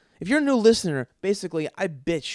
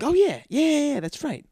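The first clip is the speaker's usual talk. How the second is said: much higher, mock-enthusiastic yes-man; eager agreeing listener; 13 semitones higher, 4 dB louder than usual. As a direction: ham up a listener nodding along.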